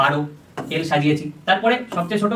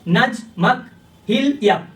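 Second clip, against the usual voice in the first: slow, word by word with pauses; sounding out code words; 2 semitones higher, much slower than usual. Shown as a demonstration. Teacher says the codes aloud so students hear the match, slow. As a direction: let each code word ring out. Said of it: "এইবার আমরা এইভাবেই উচ্চারণ করব".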